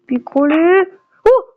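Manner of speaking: shrieking goal celebration; screaming football commentator. Real, not acted. Scoring a goal in the game.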